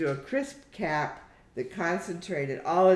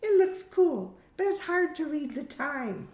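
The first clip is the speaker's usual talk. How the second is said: much higher-pitched daughter's voice; high, girlish character voice; Hamish, young daughter; 12 semitones higher than usual. Acted. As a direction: play the daughter talking to her mother, pitched way up. Daughter figure's dialogue in story.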